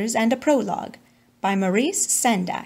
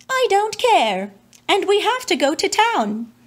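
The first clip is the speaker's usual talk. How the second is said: put-on higher boy voice; 9 semitones higher than usual. Boy interrupting mother.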